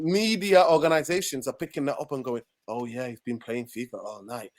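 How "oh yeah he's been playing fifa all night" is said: low, much softer mock-gossip voice. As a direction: drop the volume and pitch, imitating idle gossip. Mocking media repeating the FIFA story.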